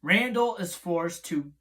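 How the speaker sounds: quiet recap narration; plot summary read. Acted. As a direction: read the synopsis off the page.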